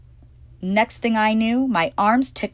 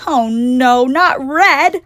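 loud, high dismayed shriek; horrified at turning red; screaming; 6 semitones higher, 8 dB louder, much slower than usual. Acted. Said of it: "I screamed".

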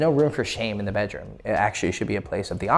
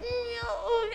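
very high, soft mock moan; squeaky pretend swoon; imitated woman's moan; 8 dB quieter than usual. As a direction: whimper it high, as a put-on female partner. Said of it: "And she's like".

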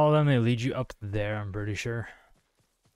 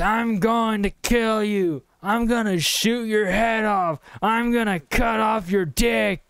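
high, hammed-up threats; mock shouting; 8 semitones higher than usual. Player mimics the enemy's threats, mock shouting. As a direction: imitate a ranting attacker, pitched way up. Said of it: "you're shouting".

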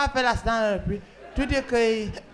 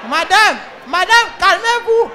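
loud calm-down; 5 semitones higher, 6 dB louder than usual. Acted. Operator calming hysterical caller.

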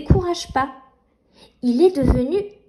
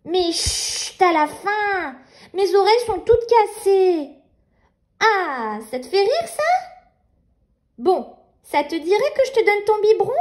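put-on little girl voice; 3 semitones higher than usual. Nina soothing baby.

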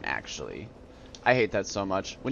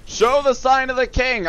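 high-pitched soldier challenge; put-on soldier voice; mock guard's demand; 10 semitones higher than usual. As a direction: demand it like a hammed-up sentry. Soldier challenges player, acted.

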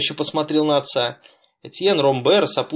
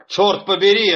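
irritated exclamation; 5 semitones higher, 4 dB louder than usual. A narrator's reading. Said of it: "раздражённо воскликнул".

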